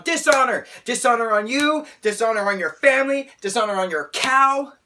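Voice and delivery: loud, high theatrical denunciation; hammed-up outraged yelling; Mushu's dishonor rant. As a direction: shout each curse as a mounting tirade. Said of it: "this level of fr-, frustration, anger".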